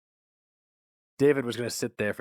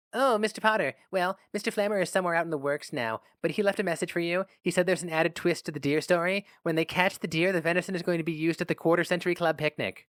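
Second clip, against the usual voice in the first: higher, brisk office secretary; 7 semitones higher than usual. A narrator's reading. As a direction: pass on the message like a chatty secretary. Secretary talks on phone.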